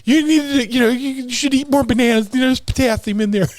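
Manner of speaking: high mock mom advice; impersonating mom. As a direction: nag your son about bananas like a doting mother. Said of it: "anytime I impersonate my mom, it always sounds like this".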